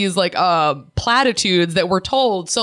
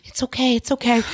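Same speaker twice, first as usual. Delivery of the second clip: soothing; 5 semitones higher than usual. Retold or imitated. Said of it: "I'm like".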